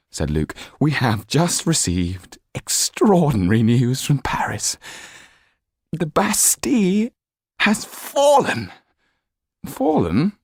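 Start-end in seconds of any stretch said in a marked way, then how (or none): 0.8-8.7 high, loud breathless news; excited valet. A narrator's reading. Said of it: "could hardly contain his excitement". 9.6-10.4 high questioning echo; disbelieving. A narrator's reading. Marquis disbelief.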